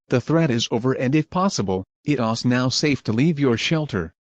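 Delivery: ordinary talk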